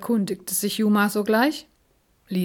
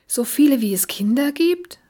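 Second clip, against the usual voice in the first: put-on higher and louder eager child question; excited call; 6 semitones higher, 4 dB louder than usual. A narrator's reading. Paul eagerly asking about the drops, higher and louder. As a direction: blurt it out all excited. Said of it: "rief Paul aufgeregt".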